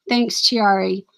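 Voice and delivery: usual speaking voice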